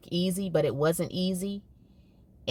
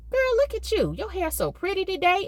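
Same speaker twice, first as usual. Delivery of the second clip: much higher, bright praise to distract; cheery distraction; 13 semitones higher than usual. Retold or imitated. Teacher distracts a crying girl, much higher voice. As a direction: gush over her hair to change the subject. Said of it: "I'm like".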